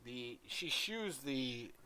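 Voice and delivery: ordinary delivery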